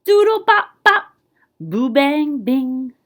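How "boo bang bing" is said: plain reading voice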